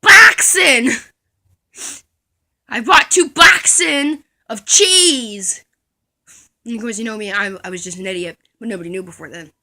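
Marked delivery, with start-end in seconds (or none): 0.0-5.6 loud, slow, proud nonsense; mock proud kid; confident wrong answer. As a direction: say it like you're sure.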